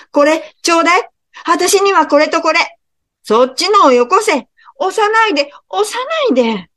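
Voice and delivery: squabbling crowd at a sale; jostling customers. Acted. (押し合う客たちの甲高い声)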